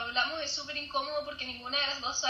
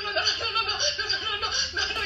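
high, laughing string of no's; playful panicked no; mortified denial; 5 semitones higher than usual. Retold or imitated. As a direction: wave it off in embarrassment. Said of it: "Y yo como".

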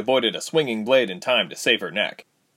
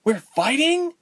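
incredulous; 3 dB louder, slower than usual. A narrator's reading. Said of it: "Ilse asked incredulously".

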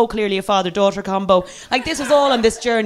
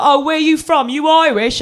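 higher-pitched mock London cabbie; London cabbie accent; 7 semitones higher, 3 dB louder than usual. London driver asking his passenger's origins, Cockney accent. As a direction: ask like a nosy London cab driver. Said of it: "one of these London taxi car drivers".

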